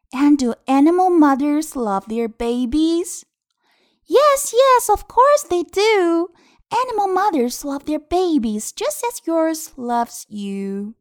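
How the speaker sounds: high, animated, drawn-out storytime voice; picture-book reading. Acted. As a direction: ask brightly, then answer with eager certainty. Reads final page to children. (高昂、有感情地给孩子读绘本)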